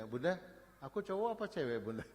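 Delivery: put-on high, questioning kid voice; imitating a small child. Three-year-old asking mother boy or girl, imitated child voice. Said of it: "umur tiga tahun masih, masih tanya".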